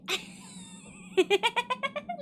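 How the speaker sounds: laughing. Real, not acted. Laughing at own hag voice.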